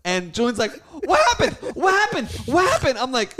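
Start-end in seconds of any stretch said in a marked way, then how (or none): 1.0-3.0 very high, loud imitation of an over-hyped fan; frantic asking. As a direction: pester with the same panicked question. Imitating hyped Julian asking repeatedly.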